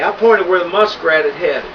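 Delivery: ordinary delivery, nothing marked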